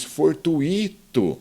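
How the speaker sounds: fortuito drawn out as for-tu-I-to; slow demo of the error for-tu-Í-to; mistaken stress. Shown as a demonstration. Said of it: "muita gente diz fortuito".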